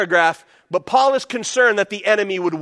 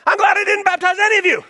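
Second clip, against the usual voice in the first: quick, higher, offhand Paul voice; mimicking Paul; 10 semitones higher, 4 dB louder, faster than usual. Pastor mimicking Paul to the congregation, quick and higher. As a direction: rattle it off like a scattered Paul. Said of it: "Doesn't he sound like Pastor Don?".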